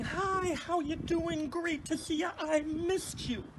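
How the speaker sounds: a hint of an owl voice saying hello; owl's happy greeting. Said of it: "which is him saying".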